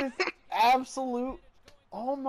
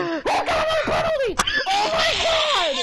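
real high, loud scream of outrage; enraged screaming; 6 dB louder than usual. Real, not acted. Gamer raging at his own penalty, enraged screaming.

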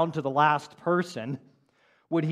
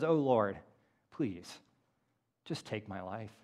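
soft falling plea, "please" and "just take my life" dropping much quieter; a broken man barely asking to die, lightly voiced; despairing plea; 4 semitones lower, 4 dB quieter than usual. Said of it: "He says".

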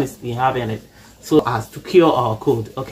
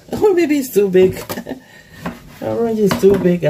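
higher-pitched, giggly amusement; laughing; 6 semitones higher than usual. Real, not acted. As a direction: laugh through the words at your own mishap. Laughs as orange won't fit jar.

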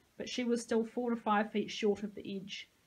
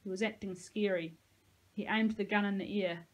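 plain reading voice

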